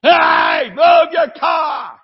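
Boston cabbie yelling at another driver; cabbie shout. Retold or imitated. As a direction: yell it out the cab window. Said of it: "They yell at each other like cabbies".

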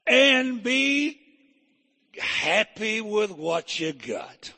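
slow, swelling then dropping; preaching scripture. Acted. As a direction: sing the verse out like a preacher. Reads Hebrews with preaching swing.

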